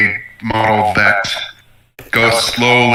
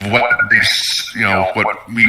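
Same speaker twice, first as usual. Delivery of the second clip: normal talking voice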